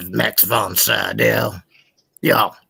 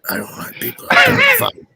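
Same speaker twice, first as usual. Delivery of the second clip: squealed mock; 4 dB louder than usual. High squeal repeat.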